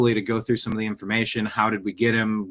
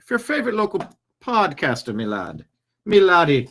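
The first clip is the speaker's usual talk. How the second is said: high, lilting mock-Irish brogue; put-on Irish; 5 semitones higher, 3 dB louder than usual. Podcaster passing the plate to listeners, playful mock Irish. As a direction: play a cartoon Irishman, pitch swooping up. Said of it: "I don't know why he's Irish".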